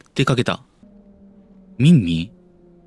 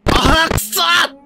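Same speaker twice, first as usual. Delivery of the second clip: high, loud, drawn-out groan of defeat; stretched-out gamer rage cry; frustrated shout; 6 dB louder, much slower than usual. Real, not acted. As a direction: let out a long angry yell. Frustrated at failing the game.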